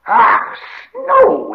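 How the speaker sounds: very high, loud Gollum screech; hissing shriek. Acted. Gollum suddenly sees Sam's fire, frightened angry shriek. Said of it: "hissing shriek and seemed to be both frightened and angry".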